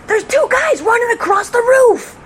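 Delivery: high, slow, loud alarm call; urgent radio call. Retold or imitated. Fellow officer on the radio reporting burglars, excited shout. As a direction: cry out the sighting as an excited report. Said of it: "comes on the radio and he says".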